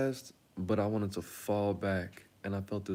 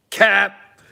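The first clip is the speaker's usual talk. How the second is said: shout; 12 semitones higher, 19 dB louder than usual. Acted. Comic outburst mid-story.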